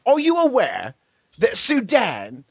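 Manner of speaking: high, slow mock British debater; hammed-up British kid cross-examining; British accent. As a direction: mimic a smug British schoolboy asking. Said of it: "when the British kid gets up to ask his question".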